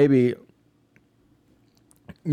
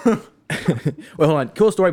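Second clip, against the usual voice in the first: normal talking voice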